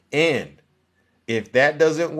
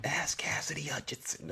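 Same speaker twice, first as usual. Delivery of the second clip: whispered aside; 15 dB quieter than usual. Acted. Host tipping off viewers, in a sly whisper.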